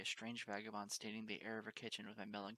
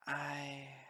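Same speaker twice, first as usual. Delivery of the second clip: a choked, higher whimper of a word; 4 semitones higher, 4 dB louder than usual. Acted. Frightened pony to the kind mare, whimpering. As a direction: gulp the word out through a whimper. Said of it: "A whimper came out of me".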